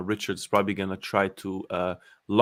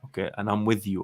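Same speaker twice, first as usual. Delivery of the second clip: ordinary delivery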